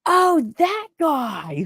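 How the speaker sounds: slow, high imitation of dawning recognition; drawn-out recognition. Streamer remembering who tried to dox her, drawn-out recognition. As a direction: stretch it out high as it clicks. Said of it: "He's like".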